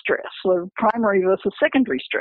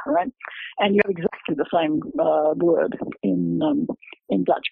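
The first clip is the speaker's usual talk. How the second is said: normal talking voice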